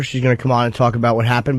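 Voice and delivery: usual speaking voice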